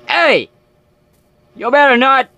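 high loud warning yell; scared shout. Real, not acted. Fearing a jump scare.

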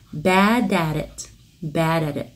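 "bad at it" as "bad-ar-it", all one flow; American-style bad at it; American flap. Shown as a demonstration. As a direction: join and flap the whole phrase. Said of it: "Trong tiếng Anh Mỹ sẽ đọc là".

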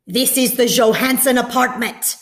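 put-on loud, heavily accented demand; loud accented soldier. A narrator's reading. Soldier at the door questioning Papa, loud and accented. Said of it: "a deep voice asked the question loudly in the terribly accented Danish".

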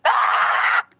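high unvoiced gasp of alarm; sudden breathy shriek; panicked gasp; startled yelp. Real, not acted. Attacked in game.

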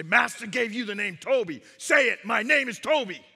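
loud, rising, insistent order; commanding slave-whipper. Acted. Whipper stopping to order the beaten man, commanding. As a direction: shout it to break his will. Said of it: "And again, breathless, he'd stop and he'd say".

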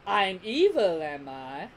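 mock-offended villain; Frieza. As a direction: feign innocence in a villain voice. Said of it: "it was funny that he said".

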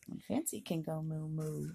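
plain reading voice

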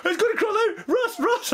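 very high-pitched, imitated frantic warning; hammed-up alarm, voice shot way up; mock panic. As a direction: squeal the warning like a panicking friend. Acts Scott panicking about escaping spider.

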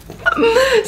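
high squealing laugh; burst of laughter. Real, not acted. Telling the family travel story, high squealing laugh. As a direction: break into a high giggle. Recalling mum's panic.

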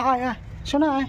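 coaxing call. Real, not acted. Calling a frightened stray dog to come, coaxing gently. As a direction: coax it closer with a sweet calling voice. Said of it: "আমি খুব আস্তে ভয়, মানে, কথা বলছি".

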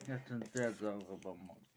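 normal talking voice

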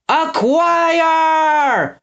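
very high, drawn-out battle cry; bellowed. A narrator's reading. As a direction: bellow one long word. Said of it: "bellowed Gristle".

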